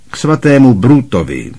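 Uncle Vernon. A narrator's reading. Vernon answers quickly.

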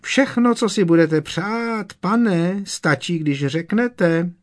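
hammed-up servile squeak, slowing at the end; house-elf imitation. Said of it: "zakvílel vysokým hlasem".

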